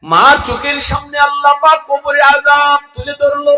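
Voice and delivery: high, loud sermon cry; full-force preaching. Real, not acted. (উচ্চস্বরে জোরালো ওয়াজ)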